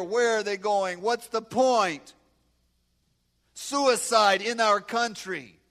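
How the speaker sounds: loud, fervent sermon voice; preaching. Acted. Preacher urging the American congregation to share their faith. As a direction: urge the congregation with passion.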